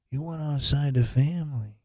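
hammed-up mobster don voice; mock mob boss, quietly accusing; bad Corleone impression. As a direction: play a mock Godfather disowning a family member. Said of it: "my bad, uh, Corleone impression".

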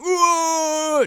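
a sudden high, loud lecherous whoa; leering whoa. Retold or imitated. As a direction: blurt it out like a horny soldier. Nick leering at every woman.

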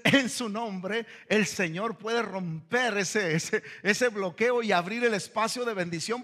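ordinary talk

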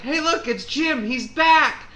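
high, bright hammed-up call; mock cheerful greeting. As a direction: greet him like a bad actor. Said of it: "someone's like".

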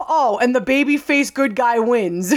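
mock retort. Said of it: "he's like".

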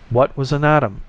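ordinary delivery

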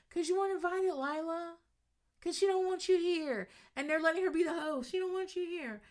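high, catty mock voice quoting the put-down. Cast member telling Lila she wasn't invited, high mocking voice. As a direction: pitch it way up and sneer it out. Catty reply in group fight.

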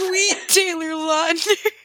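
hammed-up yelp of pain, slowly; mock pained cry. Roommate retelling the other's pained bedpost yelp, mock cry. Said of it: "And you just say".

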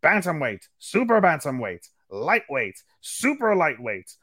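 hammed-up ring announcer. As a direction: announce every title like a boxing MC. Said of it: "That's my best, uh, Michael Buffer".

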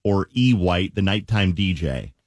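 upbeat DJ promo read; radio contest promo. Acted. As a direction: sell the contest, big radio voice.

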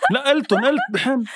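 high, insistent protest; excited protest. Real, not acted. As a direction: protest it, voice jumping up. Arguing over the game answer.

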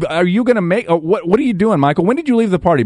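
usual speaking voice

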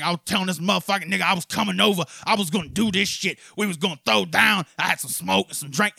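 mock the guy bragging in the store; fast, loud brag at first, settling down; imitating a loudmouth. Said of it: "he's saying this shit in front of people".

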